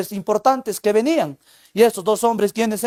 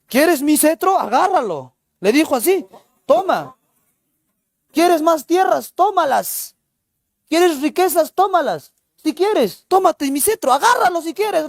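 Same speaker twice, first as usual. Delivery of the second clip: an Inca daring Ollanta to take his sceptre, sarcastic and raised; sarcastic offering; 7 semitones higher than usual. Acted. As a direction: throw each offer at him in scorn. Inca angrily offers everything.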